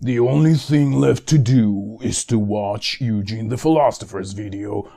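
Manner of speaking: booming trailer narrator voice; movie trailer voice. Acted. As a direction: sell it like an epic trailer. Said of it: "Hollywood trailers".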